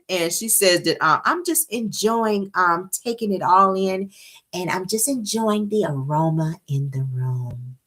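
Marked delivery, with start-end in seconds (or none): 1.2-7.8 slow, dreamy, serene imitation; unbothered zen. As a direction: drift through it, totally unbothered. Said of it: "she's in her unbothered zen".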